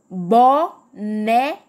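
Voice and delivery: "Boneca" slowly split as bo, ne, ca; three spaced beats in "Boneca"; syllable by syllable. Shown as a demonstration. Teacher leading viewers at home, "Boneca" said in separated syllables. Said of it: "Fala aí comigo".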